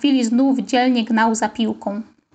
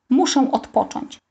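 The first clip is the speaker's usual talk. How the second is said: usual speaking voice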